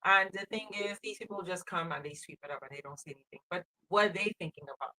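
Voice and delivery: ordinary talk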